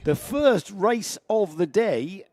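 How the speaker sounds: put-on slow, drawn-out southern English accent. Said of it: "I say that in a very southern accent".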